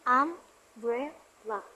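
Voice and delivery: "umbrella" slowly split into three beats; syllable by syllable. Shown as a demonstration. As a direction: say "umbrella" as um, brel, la with pauses. Breaking word into three syllables.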